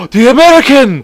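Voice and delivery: gasp, then a high, loud cry; hammed-up dramatic reveal; mock shocked realization. Officials suddenly working out the culprit, mock shocked. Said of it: "all of a sudden, like".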